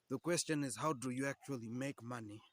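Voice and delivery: usual speaking voice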